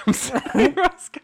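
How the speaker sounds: high, loud laughing apology. Real, not acted. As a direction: giggle through the apology. Laughing apology after nat twenty.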